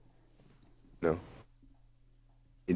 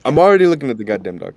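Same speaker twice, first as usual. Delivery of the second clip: exasperated shout; 5 semitones higher, 12 dB louder, faster than usual. Real, not acted. Exasperated at the screen sharing.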